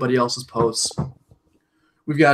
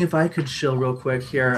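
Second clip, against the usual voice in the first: usual speaking voice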